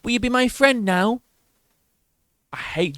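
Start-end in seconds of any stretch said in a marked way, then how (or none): 0.0-1.2 high, simpering sycophant voice; mock a fawning reporter; high sucking-up journalist voice. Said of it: "You got all these journalists like".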